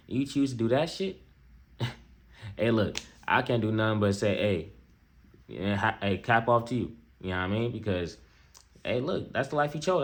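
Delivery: ordinary talk, nothing marked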